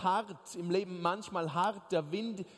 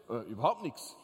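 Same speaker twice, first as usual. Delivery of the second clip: low blank reply; 7 semitones lower than usual. Retold or imitated. Husband's dull low answer.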